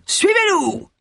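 very high shouted command; high, drawn-out cry; calling out. A narrator's reading. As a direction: call them to follow, pitched high. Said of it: "cria-t-il".

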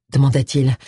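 ordinary delivery, nothing marked

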